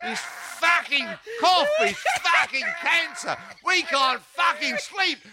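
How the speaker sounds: comic rant. Acted. Ranting chanted comic verse.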